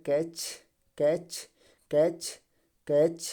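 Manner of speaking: कैच repeated as "kaich", each one apart with a pause; silent t. Shown as a demonstration. Teacher drilling the class, silent-t reading. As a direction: repeat कैच singly with no t before the ch. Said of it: "टी silent है".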